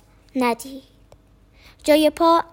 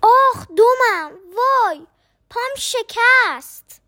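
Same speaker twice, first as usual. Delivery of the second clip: crying out in pain; 7 semitones higher, slower than usual. A narrator's reading. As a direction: howl over a broken leg. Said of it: "داد زد".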